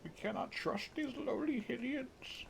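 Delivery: high, slow, haughty mock voice; snooty old fish. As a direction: look down your nose at the Hylians. Mocking the elder again.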